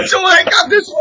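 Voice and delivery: laughing hard. Real, not acted. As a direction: burst out laughing for real. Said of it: "laughing hard".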